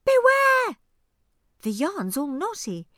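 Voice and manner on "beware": very high, loud bird call. Acted. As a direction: tweet it like a little bird. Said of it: "A bird tweets out".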